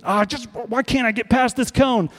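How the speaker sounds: moaning in mock frustration, pitch lifted; frustrated groan. Frustrated believer complaining about the cone of sin, exasperated whine. Frustrated self voiced, acted.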